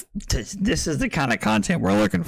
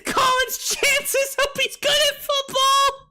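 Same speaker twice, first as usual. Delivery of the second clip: high, breaking, laughing through words; can barely get it out for laughing; laughing hard; 14 semitones higher than usual. Real, not acted. Streamer cracking up at his own joke, high teary laugh. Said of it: "without laughing".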